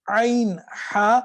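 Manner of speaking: عین ہا slow, pressed back in the mid throat; slow Arabic ain drill. Shown as a demonstration. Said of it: "اس کو پیچھے کی طرف".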